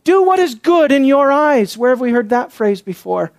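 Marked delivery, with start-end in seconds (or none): 0.0-1.8 raised, emphatic delivery; worked up. Real, not acted. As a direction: drive each phrase home in agitation. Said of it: "I'm worked up about this".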